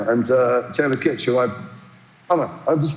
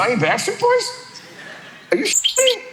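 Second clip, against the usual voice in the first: mock outraged pop star. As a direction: play an offended celebrity. Singer voiced in the story.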